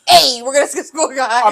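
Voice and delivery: loud mock jump-out call; popping out at them. Brothers ambushing kids, loud jump-out call. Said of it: "And we pop out".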